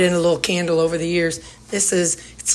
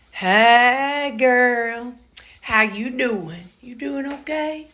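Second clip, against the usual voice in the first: cooing to the dog; 4 semitones higher, much slower than usual. Real, not acted. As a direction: coo gently to a sweet dog.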